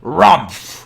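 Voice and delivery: low gruff rumph; low drowsy bear grunt; bear grumble. Acted. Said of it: "he growled".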